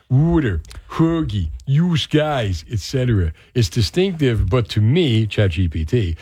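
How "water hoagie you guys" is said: sample words in a Philly accent; a touch of Philadelphia accent, word by word. Shown as a demonstration. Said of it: "tends to be more Philly adjacent".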